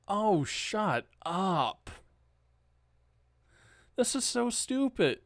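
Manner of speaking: disgusted dismissal; exasperated scoff. Real, not acted. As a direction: roll your eyes at the headline. Scoffing at the news article.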